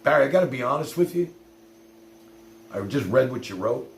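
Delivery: usual speaking voice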